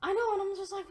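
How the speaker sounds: usual speaking voice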